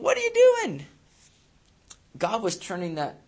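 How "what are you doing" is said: high, loud, exasperated king; much higher indignant royal squeal; king of Moab. Retold or imitated. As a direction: shriek it like a frantic king who paid for curses. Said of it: "And the king would be going".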